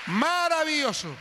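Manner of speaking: exultant cry. Real, not acted. Preacher praises God before the church, high exultant cry.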